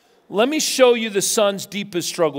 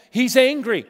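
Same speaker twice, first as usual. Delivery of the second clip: normal talking voice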